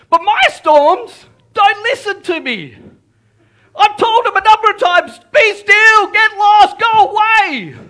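loud, high, full-voiced yelling; exasperated shout. Preacher tells how he rebuked his storms, exasperated shout. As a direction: yell as if ordering away something that won't budge.

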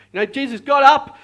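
ordinary talk, nothing marked

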